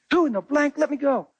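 high-pitched startled woman's voice. Retold or imitated. Startled woman snapping at the pastor in high pitch. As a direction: squeak it out in alarm.

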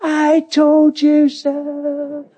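high, long-drawn mocking call; mock yelling across crowd. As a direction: stretch out a gloating far-off holler. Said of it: "you're yelling across the crowd".

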